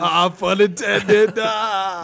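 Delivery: high, loud, slow laughing pun intended. Real, not acted. Guest laughing at the background noise pun. Joking about the crew name.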